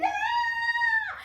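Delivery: shrill high scream; girl's high scream. Acted. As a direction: scream in terror.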